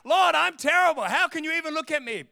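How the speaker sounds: loud, high-pitched acted self-reproach; anguished protest. Sinner stumbling in sin protests to the Lord, acted anguish. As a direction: cry out to God in mock despair.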